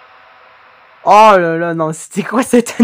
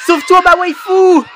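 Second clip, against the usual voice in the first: excited anime fan; 7 semitones higher than usual. Real, not acted. Fan cheering on favourite character.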